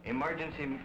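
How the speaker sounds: usual speaking voice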